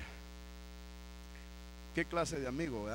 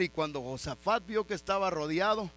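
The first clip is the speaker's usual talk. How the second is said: ordinary talk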